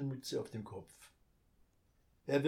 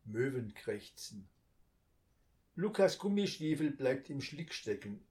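ordinary delivery

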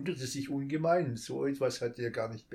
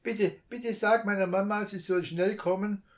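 put-on much higher, louder pleading child's voice; begging child; 8 semitones higher, 6 dB louder than usual. A narrator's reading. Child begs the thief to send mama. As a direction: beg like a small child. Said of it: "bettelte das Kind".

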